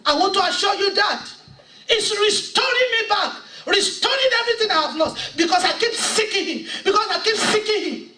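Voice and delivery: high, loud revival sermon delivery; full-force preaching. Acted. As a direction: preach your testimony with rising fire. Impassioned testimony, preaching hard.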